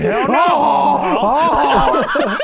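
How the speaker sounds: loud high drawn-out mock shout; hammed-up howl, stretched long. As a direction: imitate a huge yelled refusal. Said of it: "And J R says".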